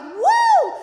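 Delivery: high, loud mock whoop and holler; mother's excited whoop. Mother thrilled on the raft, hammed-up excited shouting. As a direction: imitate an overexcited Boston mother whooping. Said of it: "she's, she's like, (thick accent)".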